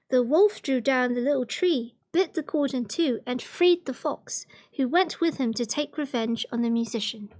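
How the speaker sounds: plain reading voice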